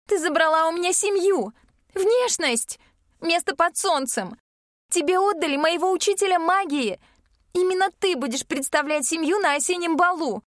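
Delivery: high, hissing accusations. Acted. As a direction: spit every word at her sister. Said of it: "шипит она".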